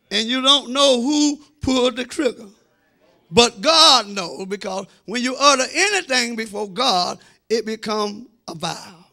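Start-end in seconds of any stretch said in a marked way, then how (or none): none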